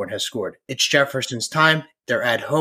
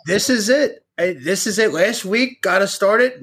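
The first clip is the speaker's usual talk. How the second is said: fired-up frat bro; 4 semitones higher than usual. Real, not acted. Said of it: "I'm fired the fuck up".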